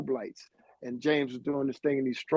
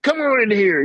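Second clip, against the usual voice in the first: a loud, much higher call of "Come on in here"; calling them in; 10 semitones higher, 8 dB louder than usual. Retold or imitated. Sonny calling the boys in through the basement window, loud call. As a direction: shout it to kids peeking through a window.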